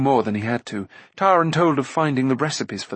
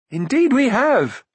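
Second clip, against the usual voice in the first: high, loud, slowed eager reply; keen interjection; 11 semitones higher, 5 dB louder, slower than usual. A narrator's reading. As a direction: chime in enthusiastically. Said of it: "put in Rune".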